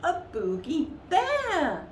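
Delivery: high, loud little bear cry; a tiny bear announcing his find; shouting little bear. A narrator's reading. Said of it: "shouted the littlest one".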